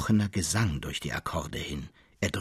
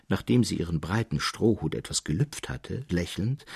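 plain reading voice

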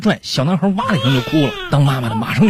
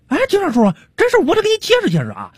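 high-pitched, stammering self-justification; comic vendor, indignant and high; aggrieved street vendor. Acted. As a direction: plead your case to the police in a high voice.

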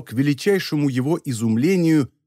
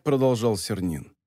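ordinary delivery

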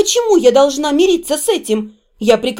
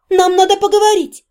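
raised pitch, firmly put; firm, higher-pitched announcement; firm statement; 7 semitones higher than usual. A narrator's reading. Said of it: "твёрдо заявила я".

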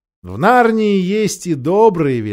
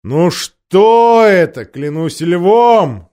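king's loud, drawn-out rebuke; loud royal scolding; 6 dB louder, much slower than usual. A narrator's reading. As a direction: boom it out slowly in exasperation. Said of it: "раздался громкий голос".

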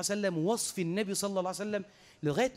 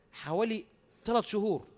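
ordinary talk

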